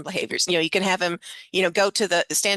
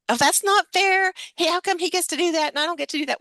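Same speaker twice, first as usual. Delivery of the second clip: put-on whiny kid; 11 semitones higher than usual. Student complaining to teacher, high whiny protest. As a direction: pitch way up and whine like a wronged child.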